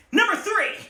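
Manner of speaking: high, loud number call; countdown shout. Acted. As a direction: announce it big.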